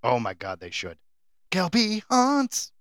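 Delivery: ordinary delivery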